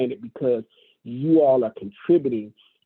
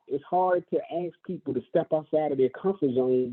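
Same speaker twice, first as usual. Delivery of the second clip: ordinary talk, nothing marked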